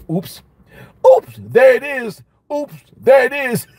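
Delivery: high, drawn-out oops of being caught. Retold or imitated. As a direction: squeal oops, caught red-handed. Caught being judgmental himself.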